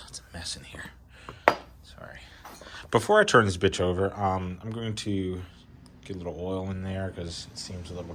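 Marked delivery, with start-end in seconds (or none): 0.1-2.2 whispering. Real, not acted. Aside about messy garage.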